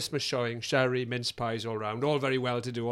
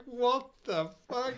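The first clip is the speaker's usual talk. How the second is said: higher, slow, stunned mock gasp of disbelief; shocked audience; 9 semitones higher, slower than usual. Cinema audience reacting to the outburst, mock stunned disbelief. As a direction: play the whole room's stunned reaction. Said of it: "the whole cinema was just like".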